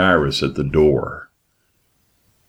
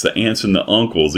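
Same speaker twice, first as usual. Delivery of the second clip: ordinary talk, nothing marked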